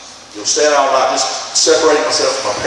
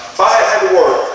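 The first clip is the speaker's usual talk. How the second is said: mildly angry protest, higher; angry questioning; 6 semitones higher, 3 dB louder than usual. Retold or imitated. Young man blaming God for keeping him at work, mildly angry. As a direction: lift the pitch in retold anger. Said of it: "the young man became angry".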